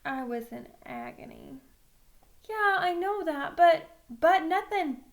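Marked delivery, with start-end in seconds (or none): 2.5-3.9 pleading protest. Acted. Boy protesting to coach. 4.1-5.0 firm, no-nonsense interruption; flat cut-off. Acted. Coach cutting off the pleading boy, flat and firm. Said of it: "Coach told me flatly".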